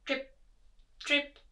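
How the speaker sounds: short high isolated drips; little plinking drip words; dripping tap. A narrator's reading. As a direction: imitate water drops, well spaced. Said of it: "went the leaky tap".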